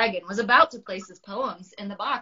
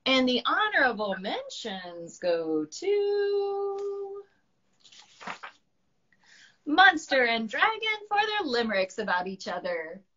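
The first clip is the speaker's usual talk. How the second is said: slow announcement; 7 semitones higher than usual. Acted. Miss Llama announcing mentions.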